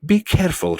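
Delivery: put-on loud, high boy's warning; shouted warning. A narrator's reading. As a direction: call out in alarm. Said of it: "shouted Milo".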